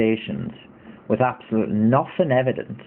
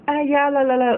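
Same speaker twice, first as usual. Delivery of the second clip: put-on very high, singsong girlish chatter; feminine chatter. Retelling walk with friends in early transition, high feminine voice. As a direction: imitate light feminine small talk in a high voice. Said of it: "I sounded very feminine".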